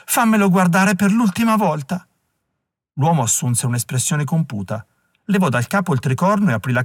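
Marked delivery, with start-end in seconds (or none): 0.0-2.1 high-pitched, mildly weepy plea; woman bursting into tears, understated; tearful plea. A narrator's reading. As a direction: plead through a touch of tears. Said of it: "La donna scoppiò a piangere".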